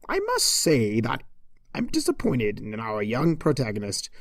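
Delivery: ordinary delivery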